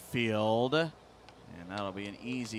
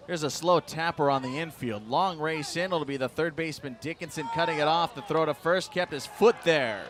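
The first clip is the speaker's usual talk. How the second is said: rising call, louder and higher; excited play-by-play; 5 semitones higher, 5 dB louder than usual. Acted. Live play-by-play of a play.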